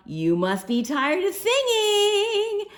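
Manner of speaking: hint with fingers crossed; hopeful hint. A narrator's reading. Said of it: "I said".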